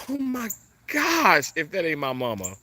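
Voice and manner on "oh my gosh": high, drawn-out real gasp of amazement; astonished cry. Real, not acted. Vlogger recognizes a sign from mama, astonished cry. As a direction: let the amazement leap out, high and long. Bird taken as mother's sign.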